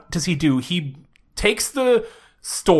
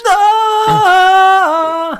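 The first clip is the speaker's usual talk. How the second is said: mock epic yell; 9 dB louder than usual. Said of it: "Like, epic music".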